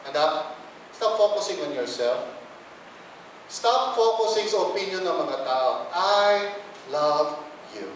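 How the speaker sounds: low, soft and gentle, slowing to the end; tender reassurance. Acted. Preacher voicing God tenderly to His child. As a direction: speak as a loving father to his child.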